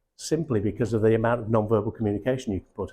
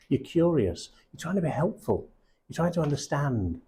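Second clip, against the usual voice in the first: usual speaking voice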